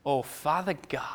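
put-on a light southern accent on a prayer opening; mock southern accent. Preacher mocking a man who prays with a put-on southern accent. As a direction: lightly imitate a Southern churchman starting to pray. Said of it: "all of a sudden he had a southern accent".

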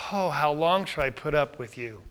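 quiet, resigned plea; weary sigh. Shown as a demonstration. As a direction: sigh it out, quietly long-suffering. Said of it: "Could you say it that way? Or like".

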